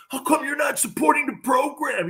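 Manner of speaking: high-pitched indignant challenge; Joe Rose. Retold or imitated. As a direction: mimic a colleague's outraged, high-voiced question. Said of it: "Joe Rose came to me and he goes".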